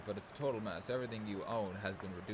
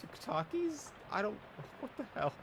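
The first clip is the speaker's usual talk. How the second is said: much higher, incredulous; baffled squeak. Real, not acted. Player baffled by an unreadable word, squeaking. Stumbling over a weird word.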